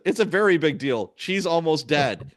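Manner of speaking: angry insistence. Retold or imitated. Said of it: "oh, I was so mad".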